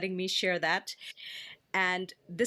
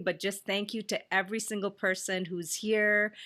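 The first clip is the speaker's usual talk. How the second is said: ordinary delivery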